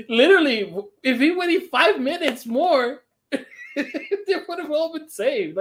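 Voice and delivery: incredulous laughter through the words; 1 laughing disbelief. Real, not acted. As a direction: laugh at how absurd it is.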